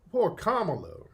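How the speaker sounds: usual speaking voice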